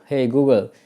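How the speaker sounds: ordinary talk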